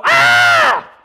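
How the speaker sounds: sudden high, loud shriek; freaked-out scream. Retold or imitated. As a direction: scream like you've just seen a ghost. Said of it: "They all freak out".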